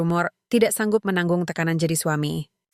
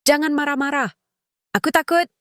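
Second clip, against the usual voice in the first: put-on high-pitched, upset little girl; frightened child; 8 semitones higher than usual. A narrator's reading. Daughter begs the quarrelling adults to stop, frightened. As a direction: plead high, like a scared child. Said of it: "Bulan mulai menangis".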